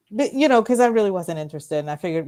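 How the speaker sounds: usual speaking voice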